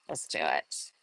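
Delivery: jokey low echo of the line; playful low voice. Pretend. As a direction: drop into a goofy deep voice. Repeats phrase in another voice.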